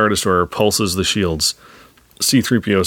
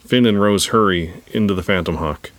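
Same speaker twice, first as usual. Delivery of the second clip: ordinary delivery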